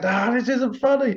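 high, squealing mock panic; mock frightened protest; imitated panicky whine. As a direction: squeal it like a man covered in spiders. Scared friend under tarantulas.

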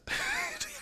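airy high-pitched giggle; high breathy giggle. Real, not acted. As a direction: let a silent giggle slip out. Laugh after admitting liking it.

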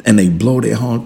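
usual speaking voice

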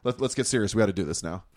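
lightly acted, low-pitched pep talk; mock gathering themselves. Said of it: "like, gather themselves".

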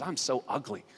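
softly acted self-belief; inner self-doubt. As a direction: let it fall away quietly. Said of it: "all you believe subconsciously is".

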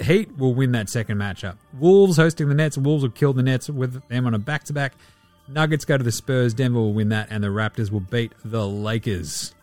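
normal talking voice

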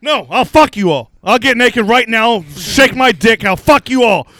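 loud, high retold rant; shouted challenge. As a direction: take on the whole crowd, loud and high. Acted threat to whole crowd.

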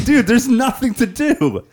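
high, loud, slow laughing whine; laughing bored whine. Retold or imitated. Mock bored complaint with laughter.

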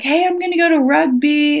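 lightly voiced upbeat friend; dorm friend. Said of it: "was like".

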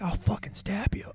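high soft mock threat; a high soft mock threat from [name].

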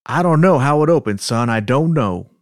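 put-on Southern accent. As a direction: read it as a worked-up Southern man. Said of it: "practice with our Southern accents".

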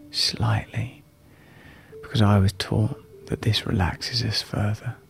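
soft breathy whisper. Acted. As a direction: whisper the guidance gently. Whispered breathing guidance in meditation.

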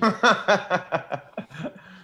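big real laugh; loud laugh. Real, not acted. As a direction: burst of high loud laughter. Shared laugh at bad luck.

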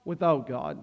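"without God" dropped low and soft; low quiet "without God". Shown as a demonstration. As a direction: let "without God" sink to a hush. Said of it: "the drastic difference between".

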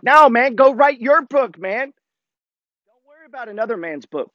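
high-pitched, emphatic brush-off; heated, incredulous refusal; exasperated retort. Retold or imitated. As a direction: shoot the offer down, dragging the final words. Retort to would-be book editor.

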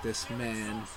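slow, weary mock lament; exhausted voice. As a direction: drag it out like worn out. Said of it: "It's like".

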